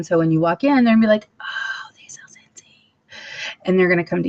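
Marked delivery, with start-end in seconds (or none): 1.4-2.9 hushed aside, breathy and unvoiced; playful gossiping whisper; mock whisper. Imagined customers noticing her.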